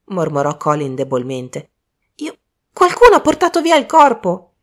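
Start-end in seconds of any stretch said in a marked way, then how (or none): 2.2-4.6 high, loud shout of alarm; alarmed cry. Acted. Michael shouts to everyone that the body has vanished. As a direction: cry out that the body's gone.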